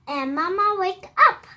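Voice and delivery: plain reading voice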